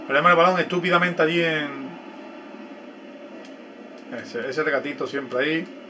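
normal talking voice

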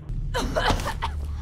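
breathy, high laugh; silent laugh. Real, not acted. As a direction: wheeze with laughter. Laughs at choking guard.

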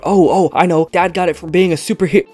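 put-on high, loud excited little girl voice; child bursting with the secret; daughter blurting out. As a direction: blurt it out like an excited kid who can't keep a secret. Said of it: "his daughter blurts out".

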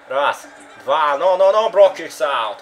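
loud, rising count and shout; excited pin count. Real, not acted. (взволнованный счёт)

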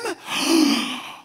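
loud, high intake of breath; big theatrical angel gasp; awestruck gasp. Acted. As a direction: gasp in mock amazement. Acting out the angels' awe.

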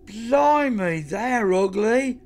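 high, loud, drawn-out disgusted amazement; appalled exclamation. A narrator's reading. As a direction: exclaim in stretched-out horror at the sight. Hobo exclaims at seeing the aliens.

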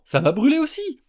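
high, loud pained complaint; high, loud startled shout; high loud cry. A narrator's reading. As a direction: cry out that it burns. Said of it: "cria Xia".

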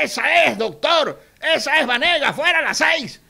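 high, loud cheering call; shouting from window. Retold or imitated. Neighbour shouting from a window at the candidate, loud cheer. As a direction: yell it out the window in support. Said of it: "te abre la ventana y te grita".

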